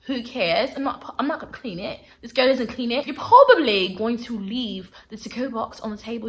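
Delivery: badly faked British accent; terrible British accent. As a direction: put on a hammy, unconvincing British voice. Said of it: "That was my terrible British accent".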